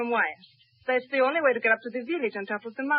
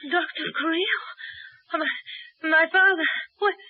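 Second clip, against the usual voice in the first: lost in fever, reaching for her father; delirious calling; 8 semitones higher than usual. Acted. Feverish daughter wakes and cries out for her father, frightened.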